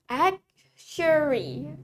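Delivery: very slow "Actuary", broken after the first syllable; "Actuary" slowly, with a long final vowel; slow model pronunciation. Shown as a demonstration. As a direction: stretch "Actuary" out for learners. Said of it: "Let's say it with me".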